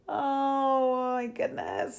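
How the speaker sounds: high, slow drawn-out awe; real cooing oh my goodness; awed gush. Real, not acted. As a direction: melt over something adorable. Awed by baby robe.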